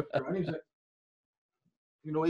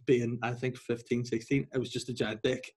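usual speaking voice

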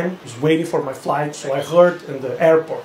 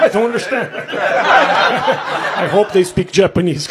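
confused Japanese traveler; 3 semitones higher, 5 dB louder than usual. Retold or imitated. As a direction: play a lost traveler straining to understand. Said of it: "he was like".

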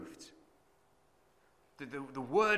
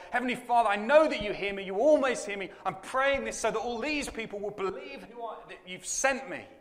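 lightly raised, louder prayer; 7 semitones higher, 4 dB louder than usual. Acted. Jesus praying aloud before the tomb, raised voice. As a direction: lift it up to heaven. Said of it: "He prays a prayer".

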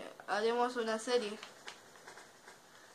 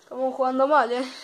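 loud; 2 semitones higher, 11 dB louder, faster than usual. Real, not acted. Frustrated at losing match.

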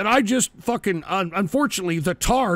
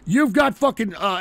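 ordinary talk, nothing marked

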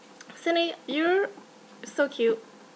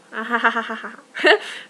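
long breathy giggling laugh; drawn-out nervous laugh; embarrassed laugh; 7 dB louder than usual. Real, not acted. Laughing after saying I love you.

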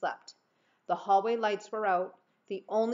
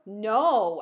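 firm; 8 dB louder than usual. A narrator's reading. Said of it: "I said firmly".

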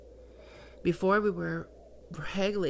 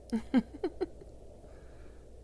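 breathy amused chuckle; 6 semitones higher, 5 dB quieter than usual. Acted. Succubus amused by flustered listener.

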